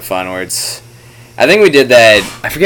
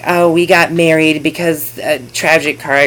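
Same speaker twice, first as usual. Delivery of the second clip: mock sappy; 7 semitones higher than usual. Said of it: "some sob shit about, like".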